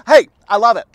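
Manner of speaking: normal talking voice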